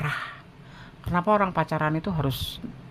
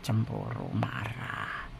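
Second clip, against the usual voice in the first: whispered; 4 semitones lower, 10 dB quieter, much slower than usual. Real, not acted. Listing jealous reactions.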